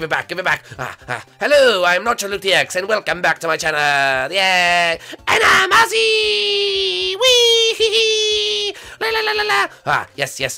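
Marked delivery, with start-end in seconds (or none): 5.3-9.7 high Ozzy voice. Pretend. Introducing Ozzy in a very high voice. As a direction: shout it out as the tiny Ozzy.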